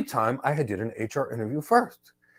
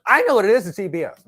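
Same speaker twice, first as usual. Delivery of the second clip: mock candidate voice; 7 semitones higher, 7 dB louder, faster than usual. Candidate answers block storage.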